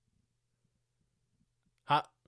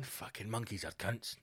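low, much softer grumble; muttered aside; 6 semitones lower, 13 dB quieter than usual. Retold or imitated. Viewer grumbling quietly at the monkey video. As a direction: mutter it under your breath. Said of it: "You're going".

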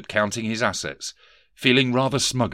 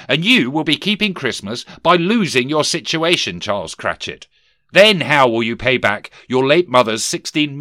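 higher, louder boss voice of a sneering Scrooge; stern miser boss; 5 semitones higher, 7 dB louder than usual. Acted. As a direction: bark it down at the clerk. Scrooge scolds clerk, mocks nephew.

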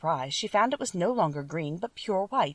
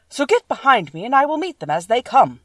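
higher, louder protective Woodman; determined Tin Woodman; 6 semitones higher, 8 dB louder than usual. A narrator's reading. Tin Woodman shielding friends from wolves, louder. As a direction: order them behind you bravely.

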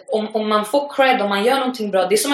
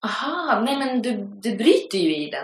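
higher, knowing aha from the student; imitated student; 3 semitones higher, 4 dB quieter than usual. The student insisting again, lightly mimicked. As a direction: play her as stubbornly sure.

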